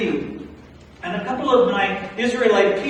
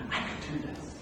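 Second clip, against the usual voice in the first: breathy whisper to himself; quietly convince yourself; whispered resolve; 12 dB quieter than usual. Acted. Moses whispering to himself that he can do it.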